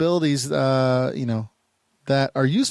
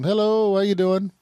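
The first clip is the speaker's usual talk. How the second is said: demo phrase; 7 semitones higher than usual. Shown as a demonstration. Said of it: "so I'll say".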